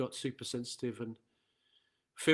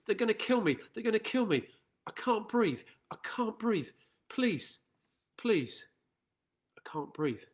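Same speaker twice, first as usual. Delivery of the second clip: pleading voice rising high and strained; reading George Floyd's last words; 5 semitones higher, 5 dB louder than usual. Retold or imitated. Preacher reads the dying man's pleas to students, high anguished pleading. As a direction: plead for breath, voice climbing high and desperate. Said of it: "I read to the students this following".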